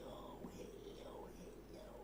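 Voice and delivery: whispered hellos. Real, not acted.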